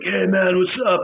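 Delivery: lightly voiced laid-back Spicoli-type dude; a hint of a drawling surfer; stoner surfer dude. Shown as a demonstration. Casting director describing the role, mock surfer dude. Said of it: "A Jeff Spicoli character".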